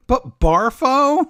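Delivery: high, slow disbelieving "Barfo"; incredulous. Real, not acted. Co-host reacting in disbelief to the silly name Barfo. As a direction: drag it out in disbelief. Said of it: "incredulous at the name".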